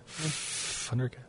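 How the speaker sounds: "Thundercats" whispered as Fundercats; whispered F sound. Shown as a demonstration. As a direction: breathe "Thundercats" slowly with an F. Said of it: "drawed out the F on Thundercats".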